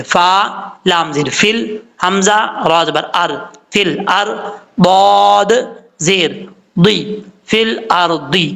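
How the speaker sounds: फिल अर्दी slow, र full and heavy; spelling recitation. Shown as a demonstration. Teacher spelling the syllables slowly for students. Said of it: "रा पुर पढ़ा जाता है".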